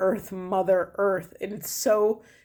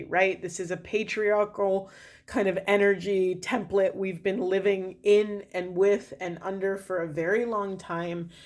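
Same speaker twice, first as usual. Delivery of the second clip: usual speaking voice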